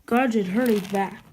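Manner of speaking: usual speaking voice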